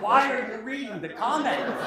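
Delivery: mock-outraged, laughing as you ask; incredulous laughing retort.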